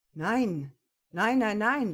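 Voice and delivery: loud hasty nein, nein; loud flustered denial; urgent denial. A narrator's reading. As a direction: cut her off fast, protesting. Jonas panicked denial.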